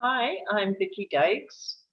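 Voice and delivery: ordinary talk, nothing marked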